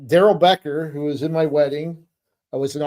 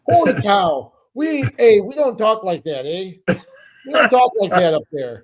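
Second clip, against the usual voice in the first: higher, louder mock Minnesota rant, eh; Minnesota accent; 4 semitones higher, 3 dB louder than usual. Retelling the teammate's Fargo rant to the co-host, in a hammed-up Minnesota accent. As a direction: ham up the Minnesota accent, indignant. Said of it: "sounding just like one of the characters".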